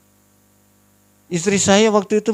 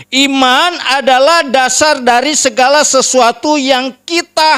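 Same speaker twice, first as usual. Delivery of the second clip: emphatic scripture preaching; 8 semitones higher, 4 dB louder than usual. Real, not acted. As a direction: proclaim the verse to the congregation. Proclaims scripture at full force. (membacakan ayat dengan lantang dan tegas)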